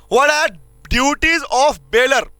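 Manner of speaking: words spaced out for note-taking; slow classroom dictation. Shown as a demonstration. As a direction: dictate it slowly for the class to copy. Teacher dictating notes.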